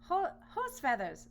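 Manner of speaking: slow, high, loud scoff; scoffing boar. Acted. Second boar dismissing the story, slow and high. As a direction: scoff in disbelief. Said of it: "said the other boar".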